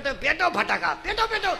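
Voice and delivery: high, eager repeated command; excited urging. Acted. Supporters egging each other to light crackers, excited urging.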